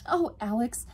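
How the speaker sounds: high, earnest request; sighing appeal. A narrator's reading. Yvonne asking Alex to check on Freya, earnest. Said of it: "Her eyes widened and she sighed".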